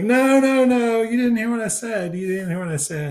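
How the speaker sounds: high, insistent retold denial; mock backpedaling. Manager talking his way out with the ump, mock protesting. Acts out backpedaling before the umpire.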